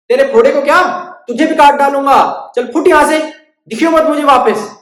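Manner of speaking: loud, high furious barber; angry threat. Acted. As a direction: yell the threat, full of menace.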